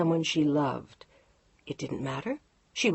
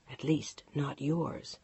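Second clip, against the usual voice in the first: softer, cold aside; quiet; 3 semitones lower, 7 dB quieter, slower than usual. A narrator's reading. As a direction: drop it low and cutting. Quiet cutting afterthought.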